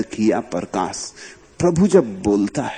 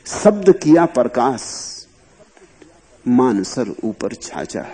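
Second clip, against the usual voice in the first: slow verse recital; 2 semitones higher, slower than usual. Acted. Master recites Paltu's verse to his listeners, slowly intoned. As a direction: chant the line slowly, savouring each word.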